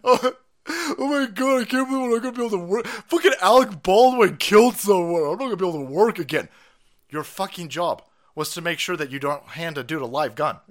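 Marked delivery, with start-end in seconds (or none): 0.0-6.5 high, fast, loud mock wail; hammed-up fake sobbing whine; sneering parody of a crybaby; mock crying armorer. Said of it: "was crying that they weren't gonna be able to work again".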